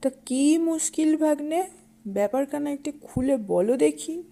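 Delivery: cunning fox uncle, friendly and curious. Acted.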